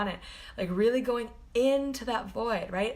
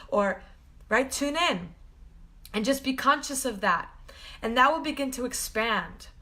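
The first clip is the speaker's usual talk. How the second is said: usual speaking voice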